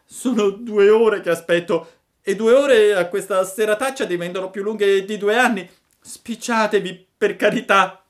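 acuto e fortissimo, disperato; supplica gridata. A narrator's reading. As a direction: implora urlando dal freddo. Pinocchio implora gridando. (Pinocchio implora la lumaca, urlando acuto)

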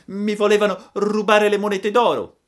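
high, loud puppet voice; an indignant little boy; puppet complaint. Acted. Pinocchio complains. (burattino indignato, voce alta)

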